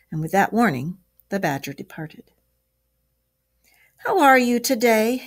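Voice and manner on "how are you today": higher, louder, cheery greeting; chirpy storybook inquiry; cheerful. A narrator's reading. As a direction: greet the patient brightly. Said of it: "inquired the Rat cheerfully".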